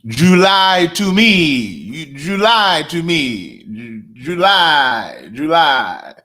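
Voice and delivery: "July" stretched slowly with big pitch swoops, JOOO-LIIIE; hammed-up, drawn-out "July", each syllable full; exaggerated July. Shown as a demonstration. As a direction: draw out both syllables of "July" in a swooping singsong. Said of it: "make two big distinctions in the syllables".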